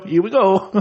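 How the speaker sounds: high, cartoonish mock Korean accent; Korean Mario impression. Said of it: "Korean Mario?".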